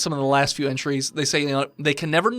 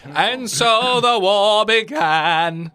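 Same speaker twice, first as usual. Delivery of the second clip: epic fantasy narrator; 4 semitones higher, 4 dB louder, much slower than usual. A narrator's reading. DM closing the story dramatically.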